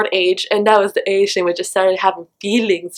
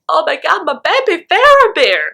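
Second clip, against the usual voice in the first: put-on squealing high over her baby Faramir; gushing fangirl; 6 semitones higher than usual. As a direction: swoon over him with a higher, gushing voice. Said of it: "I was like".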